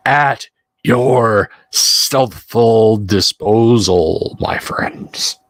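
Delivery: slow, drawn-out conspiratorial whisper; drunk's showy stealthy whisper; stage whisper. Acted. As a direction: whisper it with ceremonious care, word by word. Noisy helper plays at being stealthy.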